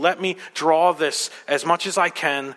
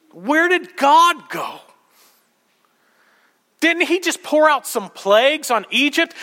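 high, loud preacher's cry; impassioned preaching; 14 semitones higher, 6 dB louder than usual. Acted. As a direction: press each question out to the congregation with force. Preaches at full force.

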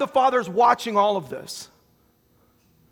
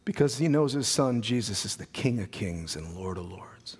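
sudden hushed whisper, much softer and lower; 10 semitones lower, 7 dB quieter than usual. Real, not acted. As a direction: drop to an awed whisper. Sudden hush after climax.

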